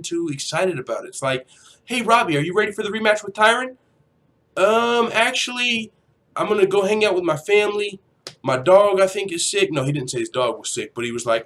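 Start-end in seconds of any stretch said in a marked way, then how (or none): 1.8-3.8 higher, quicker hyped-up imitation; mock an excited interviewer putting the question; eager questioner. Voices an eager asker. 4.6-9.7 high-pitched imitation of someone dodging; hammed-up hesitant excuse, pitched high; mock reluctant excuse. Mimics Robbie making lame excuses.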